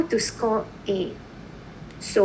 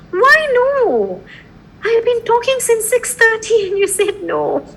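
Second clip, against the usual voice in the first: high, loud disbelieving protest; playful outraged teacher; mock exasperation; 11 semitones higher, 4 dB louder than usual. Real, not acted. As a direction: act indignant that they said no after all that teaching.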